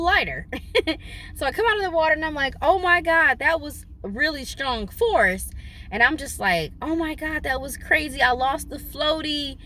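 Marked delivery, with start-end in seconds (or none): 2.6-5.5 shocked. Retold or imitated. Shocked after losing wig underwater. 6.8-9.6 shocked. Retold or imitated. Retold shock at losing floaty.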